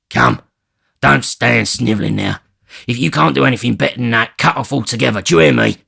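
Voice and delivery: loud, fast threatening rant; furious robber shouting at the girl; angry Sikes. Acted. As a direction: berate her, building. Sikes berates Nancy.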